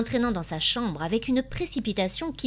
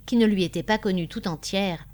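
plain reading voice

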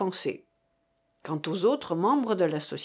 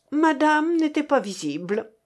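higher-pitched, stung reply; acid, pitched-up retort; sour retort; 6 semitones higher than usual. A narrator's reading. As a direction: answer back with sourness. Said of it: "répondit avec aigreur mademoiselle Sophie".

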